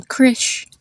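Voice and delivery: "Krish" with the I of kit; short I in Krish. Shown as a demonstration. Teacher giving another example, short I in "Krish". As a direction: keep the vowel in "Krish" short, as in sit. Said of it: "has a short I sound".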